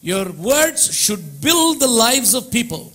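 slow, high, emphatic; fervent preaching. Acted. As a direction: press the truth home. Preaching slowly.

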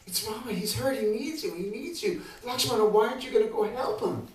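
high, urgent panicked pleading; rising panic, begging him to go; high frantic woman's voice; panicked Sita. Acted. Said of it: "Sita went into a panic".

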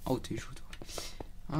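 slow soft whisper. Real, not acted. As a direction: breathe it out slowly. Speaks softly to a game character.

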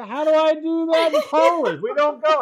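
loud, high comic protest; exasperated translator. Acted. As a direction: protest in hammed-up bafflement.

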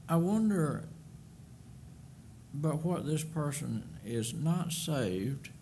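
ordinary talk

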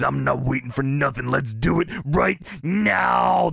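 imitated wrestler promo, dragged out slowly at the end; Macho Man. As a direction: stretch the challenge like a showman. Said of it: "And Macho says".

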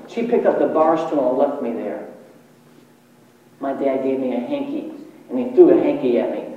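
ordinary delivery

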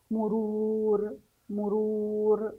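مرور slow, with a long held "ruuur"; lengthened letter. Shown as a demonstration. Teacher says we lengthen the letter, drawn-out demo. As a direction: stretch the second syllable of مرور on a level tone. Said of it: "سنمد قليلًا الحرف".

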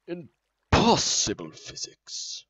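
loud, high and drawn-out; booming exclamation. Pretend. Player marvelling at the game's physics, booming exclamation. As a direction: boom it out slowly, stretching the words.

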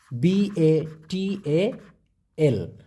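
slow letter-by-letter spelling of বাতাল. Shown as a demonstration. Teacher spelling a Malay word for students, slowly. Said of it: "উচ্চারণটা হবে".

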